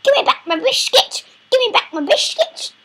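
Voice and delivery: high, loud, fast grumpy grandma; squeaky demanding grandma. Pretend. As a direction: screech like a cross old granny. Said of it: "The grandma on the bus goes".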